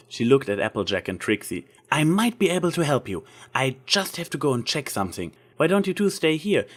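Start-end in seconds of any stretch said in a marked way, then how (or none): 1.9-6.7 put-on a slightly higher, eager young mare's voice; bright helpful friend, lightly played; lightly voiced Twilight. A narrator's reading. Twilight offering to help her friends, lightly voiced.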